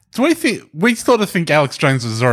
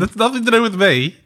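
raised-pitch mock protest; protesting Bill Gates; 8 semitones higher than usual. Joking as Bill Gates at the rally chant, mock protest. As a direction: plead innocence in a higher voice. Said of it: "Bill Gates would be like".

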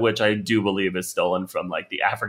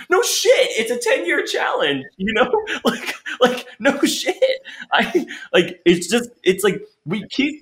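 high-pitched exasperation; 12 semitones higher than usual. Real, not acted. Ranting about ten-year challenge posts.

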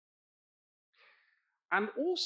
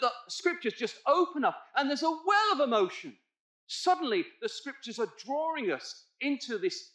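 impassioned preaching; 8 semitones higher, 6 dB louder than usual. Acted. Preacher urging congregation to feel Esau's loss, impassioned. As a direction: build the appeal to a climax.

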